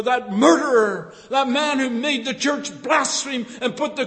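loud, high, fervent preaching; preaching at full force. Acted. Preacher exhorting the congregation, loud and fervent. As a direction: proclaim it to the back row.

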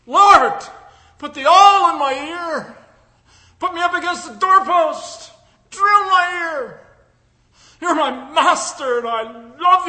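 high, loud pleading cry to the Lord; voicing a devoted slave's prayer. Preacher voicing a believer's prayer to the Lord, loud and high. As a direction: cry out like a slave begging to be bound forever. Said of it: "Do you say to yourself this morning".

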